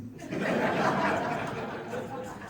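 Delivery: audience laughing; big laugh from the crowd; crowd laughter. Real, not acted. Audience laughs after joke.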